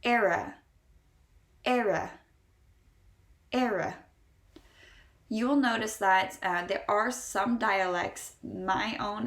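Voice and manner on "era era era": "era" with a clear R and final A sounded; light E, firm R, then A in "era"; stressed middle R. Shown as a demonstration. Teacher drilling learners on "era", middle R stressed. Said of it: "The R in the middle is stressed".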